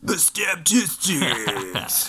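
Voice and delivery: dramatic announcer. Pretend.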